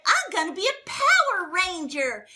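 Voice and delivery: put-on a child announcing he'll be a clown; little boy voice. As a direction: play the small boy, eager and proud. Other little boy answers.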